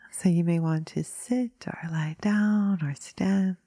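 drifting into whispers between long pauses; guided meditation. Acted. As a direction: ease listeners gently into stillness.

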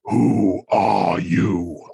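slow, eerie demon-possessed voice; possessed woman. Retold or imitated. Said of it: "looks at somebody and goes".

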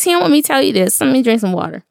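ordinary talk, nothing marked

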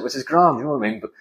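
usual speaking voice

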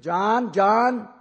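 loud, high mock mom calling John twice; imitating a mother calling her son home. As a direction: call John, John like a mother summoning her kid. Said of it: "tone of voice and the repetition".

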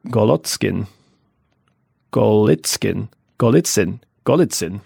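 Golotskin, Golitskin, Golitsyn, each tried separately; trying out names. Shown as a demonstration. Reader trying out Prince Golitsyn's name, tentative. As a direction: test each version of Golitsyn with pauses. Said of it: "trying these names out".

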